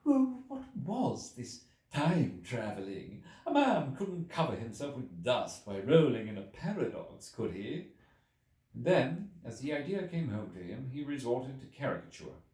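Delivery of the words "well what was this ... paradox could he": slow, scoffing editor voice; an incredulous editor, drawn out; objecting editor. A narrator's reading. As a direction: raise the objection with disbelief. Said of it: "The editor raised objections".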